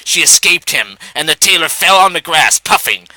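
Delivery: usual speaking voice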